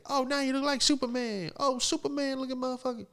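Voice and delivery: very high put-on female voice; hammed-up high-pitched teasing; rowdy heckling lady. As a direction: hoot it to a crowd of friends in a high mock woman's voice. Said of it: "she's, like, recruiting all her friends".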